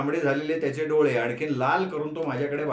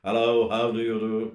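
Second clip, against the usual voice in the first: low, very slow, formal English greeting; a stiff gentleman offering his hand; stiff formal father; 4 semitones lower, much slower than usual. A narrator's reading. Father greeting daughter's friend, low and stiffly formal.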